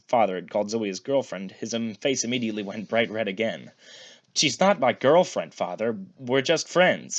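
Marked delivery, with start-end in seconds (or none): 4.3-7.2 louder, higher protest, flustered; arguing. A narrator's reading. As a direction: protest loudly, embarrassed. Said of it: "he tried to argue".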